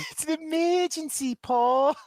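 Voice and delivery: very high mock-Aussie alarm; Australian accent. Co-host topping the go-to line, high Australian accent. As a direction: cry it out in broad Australian.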